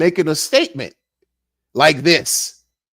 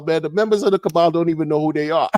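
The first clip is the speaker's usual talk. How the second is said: ordinary delivery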